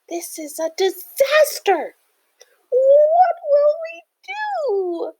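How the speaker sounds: very high, stretched-out wail; drawn-out high squeal of despair; distressed scribbles. A narrator's reading. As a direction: cry out in panic. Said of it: "the scribbles all cried".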